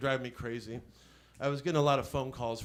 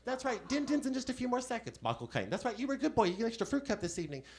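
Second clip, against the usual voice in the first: much higher, prim woman's voice; secretary voice; 9 semitones higher than usual. Acted. Comic poses as Caine's secretary to the scammer, high prim woman. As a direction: pitch way up as a fussy secretary. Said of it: "Barbara Johnson".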